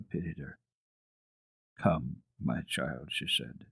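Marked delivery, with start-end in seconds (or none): 1.8-3.1 slow, soft and gentle; gentle comfort. A narrator's reading. Grandmother softly coaxing Heidi to listen, slow and tender. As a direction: coax the sad child gently.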